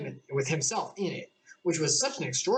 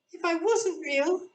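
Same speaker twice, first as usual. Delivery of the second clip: much higher, slow, a mild tearful Alice; lightly played tears and laughter; a hint of a crying girl; Alice half laughing through tears; slower than usual. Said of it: "half laughing through her tears".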